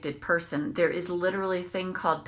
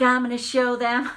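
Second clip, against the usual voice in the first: higher and louder vow of payback; retold threat; 5 semitones higher, 5 dB louder than usual. Following the car, she vows to teach the driver a lesson. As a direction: bark it like a threat.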